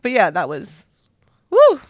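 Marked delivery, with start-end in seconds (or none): 1.5-1.8 a loud, high release of breath to shake off tears; woo exhale. Real, not acted. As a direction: blow the emotion out with a sudden woo. Exhaling after an emotional memory.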